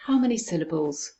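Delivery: ordinary talk, nothing marked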